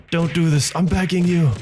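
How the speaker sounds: high, loud desperate plea; crying out in protest. A narrator's reading. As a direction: beg at the top of your voice. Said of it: "Slifer cries out in protest".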